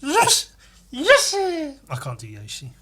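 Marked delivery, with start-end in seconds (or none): 0.0-1.4 rising, high loud yeses; Mario noises. Retold or imitated. Comedian doing Mario cheers at the mirror. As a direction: climb higher on each yes. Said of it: "Mario noises".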